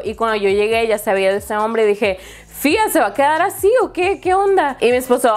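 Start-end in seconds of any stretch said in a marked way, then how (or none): 2.5-4.8 high-pitched incredulous complaint; dismayed questioning. Retold or imitated. Wife finds the hole in the door, dismayed questioning. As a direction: re-enact a put-out question, voice pitched way up.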